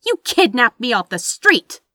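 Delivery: high, loud indignant retort; indignant snap. A narrator's reading. Kidnapped sister answers her captor, indignant snap. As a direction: snap back, outraged. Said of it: "Anathema snapped back indignantly".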